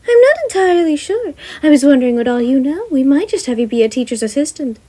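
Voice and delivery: high, bright-voiced principal; Nezu voice. Nezu asking Gem about housing, high character voice. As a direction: lift the pitch way up for the chimera principal.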